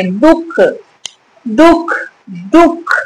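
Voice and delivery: "दुख" said short, with weight on दु and a stopped ख; stress before visarga. Shown as a demonstration. Teacher demonstrating visarga stress, short stressed "दुख". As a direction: press दु in "दुख" and cut it off at ख. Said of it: "उन पर बलाघात होगा ही".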